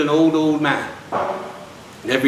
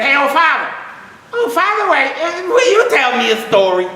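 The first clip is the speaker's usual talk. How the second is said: put-on high, louder childlike pleading; eager little boy; 10 semitones higher, 3 dB louder than usual. Little boy begging old father for a story, high and eager. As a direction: beg like an excited kid.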